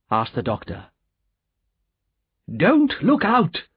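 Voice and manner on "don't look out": high, drawn-out warning; alarmed cry. A narrator's reading. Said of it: "cried Mister Lorry".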